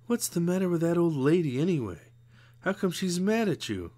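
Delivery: put-on a touch of a kid grumbling; mildly voiced girl scorn; young girl Rose Rita. A narrator's reading.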